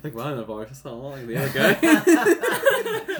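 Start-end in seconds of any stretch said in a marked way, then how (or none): 1.4-3.2 laughing. Real, not acted. Player laughing at joke.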